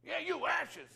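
high, insistent calling out; retold call. Customer singling out the man in the waiting room, high insistent call. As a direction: point him out, high and eager. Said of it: "he says".

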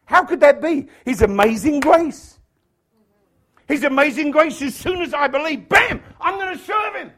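high, excited preaching shout; carried-away preacher at full volume; getting loud. Real, not acted. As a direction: build to a shouted declaration of faith. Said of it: "Sorry, I'm getting loud".

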